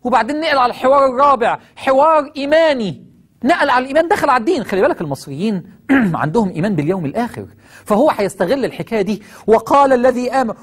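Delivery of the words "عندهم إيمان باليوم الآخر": usual speaking voice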